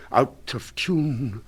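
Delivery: ordinary delivery, nothing marked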